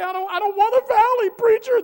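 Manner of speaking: high, loud mock whine; whining listener. Mimicking a churchgoer whining to the preacher, high and loud. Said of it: "You say".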